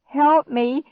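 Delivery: high, loud panicked wail. A narrator's reading. Clark wailing, thinking a gator grabbed him. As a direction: beg for rescue in terror. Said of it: "Clark wailed".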